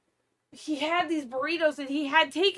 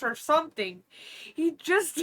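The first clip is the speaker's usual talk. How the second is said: ordinary delivery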